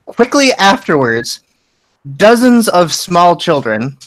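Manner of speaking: normal talking voice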